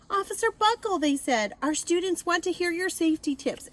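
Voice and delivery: high excited school callers; eager callers. A narrator's reading. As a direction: plead brightly down the phone. Schools calling on phone.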